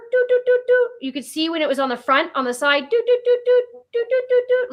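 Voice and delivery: high beeping doot, doot, doot; high imitated sensor beeps. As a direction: copy the van sensor beeping. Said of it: "the sensor".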